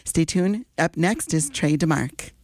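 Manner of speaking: ordinary delivery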